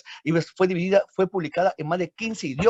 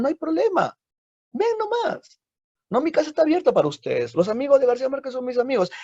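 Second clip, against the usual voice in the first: much higher, eager host voice; welcoming friend; 10 semitones higher than usual. Acted. Neighbour welcomes the author's guests, high and eager. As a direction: throw the door open like a generous neighbour.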